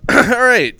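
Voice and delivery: ordinary delivery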